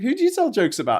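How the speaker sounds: high, loud retold question; mock escalating question. Sketch man asking at the last street, high and loud.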